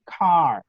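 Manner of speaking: car drawn out with a curled English r. Shown as a demonstration. Teacher giving an English example, drawn-out English r in car. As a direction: hold the untrilled r at the end of car. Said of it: "como una R amarrada".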